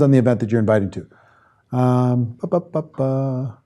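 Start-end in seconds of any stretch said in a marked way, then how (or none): none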